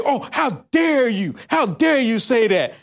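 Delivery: slow, indignant "how dare you"; mock outrage. Mocking upset progressives who answered his post, slow outrage. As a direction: ham up their shocked offence. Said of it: "They was like".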